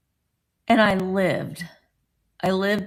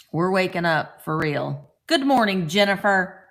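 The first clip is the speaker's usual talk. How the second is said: ordinary talk, nothing marked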